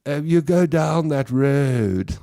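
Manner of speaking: slow, laid-back Cape Town drawl; relaxed Cape Townian. Retold or imitated. Comic playing a Cape Townian giving slow directions. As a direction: take your time, everything flows. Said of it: "Then the Cape Townian goes".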